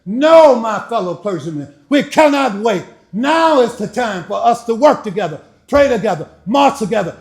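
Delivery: normal talking voice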